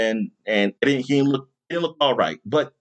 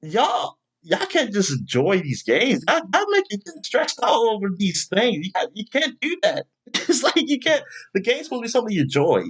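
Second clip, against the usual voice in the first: exasperated scolding; 9 semitones higher than usual. Retold or imitated. Scolding fans online.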